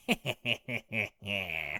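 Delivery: evil cackle; villain laugh. Retold or imitated. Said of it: "like a real villain laugh".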